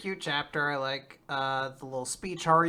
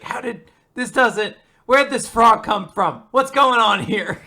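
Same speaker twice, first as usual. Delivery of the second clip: louder, higher, slow flustered babble; clueless confusion; 6 semitones higher, 11 dB louder, slower than usual. Retold or imitated. Code unable to explain the frog, loud slow flustered. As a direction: flounder for an explanation. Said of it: "He's like".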